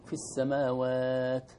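السماوات slow, taa without hiss; teacher's model; slow correct model. Shown as a demonstration. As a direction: recite في السماوات slowly and cleanly. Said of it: "بدون همس صحيح".

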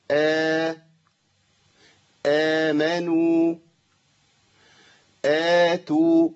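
slow, evenly held vowels in آمنوا, آتوا; two-count madd. Shown as a demonstration. Teacher giving the pattern, آمنوا and آتوا evenly stretched. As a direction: chant each opening aa of آمنوا and آتوا long and level. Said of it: "بزمن الحركتين فقط".

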